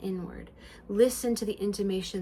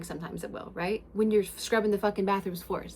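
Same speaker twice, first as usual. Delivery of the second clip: ordinary talk, nothing marked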